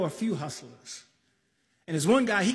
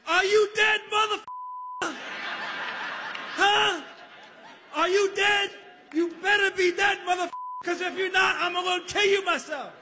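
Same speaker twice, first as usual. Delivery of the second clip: yelling at the dead; 8 semitones higher than usual. Retold or imitated. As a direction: shout it up into the mirror, half laughing.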